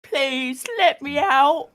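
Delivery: high, loud, slow mock plea; Gibby impression. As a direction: draw out a hammed-up British cry for help. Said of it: "doing an impression of Gibby".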